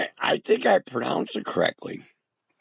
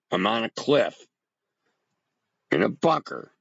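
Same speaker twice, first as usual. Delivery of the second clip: ordinary talk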